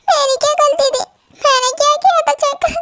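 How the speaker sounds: high, tearful plea, voice near breaking; tearful complaint. Acted. Slapped sister asks her brother why, tearfully. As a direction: ask what you did wrong as if holding back tears. Lisa hurt after being slapped.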